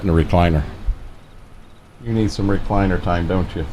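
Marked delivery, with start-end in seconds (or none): none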